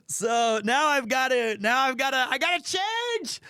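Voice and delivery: rising into a high comic squeal; hammed-up flustered panic, climbing in pitch; mock panic. Mock panic, must change his mood.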